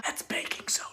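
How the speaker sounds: soft whispered correction; whispered aside. Acted. Host corrects a slip, whispered to the viewers.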